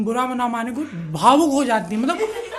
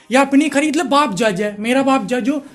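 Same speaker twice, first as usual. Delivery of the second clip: ordinary talk, nothing marked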